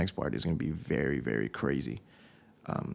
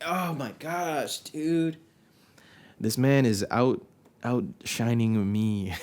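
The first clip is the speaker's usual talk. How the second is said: exaggerated dismay; 4 semitones higher, much slower than usual. Jealous reaction retold.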